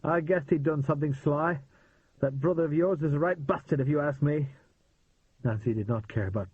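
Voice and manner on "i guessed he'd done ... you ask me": put-on light British accent, a touch higher; a hint of a British man. A narrator's reading. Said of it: "It was a British accent".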